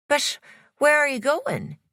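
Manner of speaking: high, anxious Irish man; Irish accent. A narrator's reading. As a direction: call after her in an Irish accent. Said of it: "He had an Irish accent".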